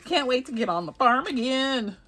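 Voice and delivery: lightly put-on Texas twang; Texas accent. Joking to viewers about the farm, playful Texas accent. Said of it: "That's my Texas accent".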